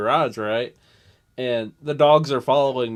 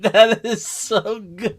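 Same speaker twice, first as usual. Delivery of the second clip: slow delighted; 9 semitones higher, 5 dB louder, much slower than usual. Retold or imitated. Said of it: "I was like".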